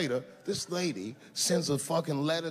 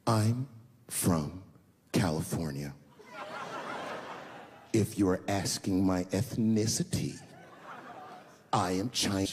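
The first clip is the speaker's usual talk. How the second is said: condescending; 7 semitones lower than usual. Retold or imitated. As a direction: talk down to him, spacing the words. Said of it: "She goes, very condescendingly".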